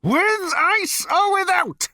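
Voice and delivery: high, loud, drawn-out yell; furious bellow. A narrator's reading. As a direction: bellow each word slowly at the prisoners. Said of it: "bellowed number two".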